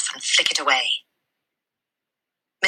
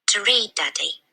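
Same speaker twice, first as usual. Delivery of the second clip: put-on earnest little girl; 5 semitones higher than usual. Matilda answers her father.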